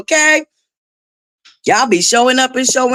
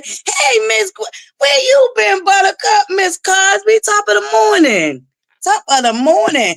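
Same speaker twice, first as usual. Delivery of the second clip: much higher squealing hey, then high singsong greeting; squealed greeting; 9 semitones higher than usual. Real, not acted. Host spotting a returning viewer, much higher excited squeal.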